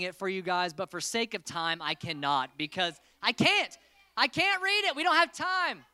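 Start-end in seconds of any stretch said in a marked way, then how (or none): 3.2-5.8 high, loud teasing refusal; playful protest. Real, not acted. Youth pastor teasing kids who want the story, high playful whine. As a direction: tease them, half-whining.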